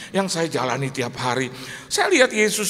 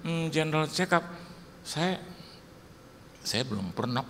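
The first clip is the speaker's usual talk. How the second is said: sheepish; 8 dB quieter than usual. Retold or imitated. Pastor admits he never had a checkup, softly and sheepishly. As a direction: mumble it, embarrassed.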